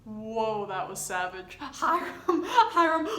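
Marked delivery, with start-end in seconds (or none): 1.6-3.1 high laughing wail of a name; mock wailing. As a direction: cry out the name while laughing. Mock wailing over a character.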